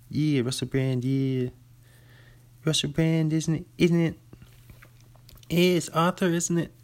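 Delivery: rough, high-pitched British impression; playful cod-British voice with questioning tags; mock British accent. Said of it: "that's how you do a British accent".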